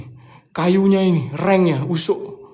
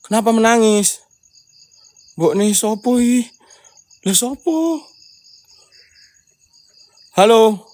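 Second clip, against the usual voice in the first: high loud call; 7 semitones higher, 6 dB louder, slower than usual. Real, not acted. Calling to an unseen crying child.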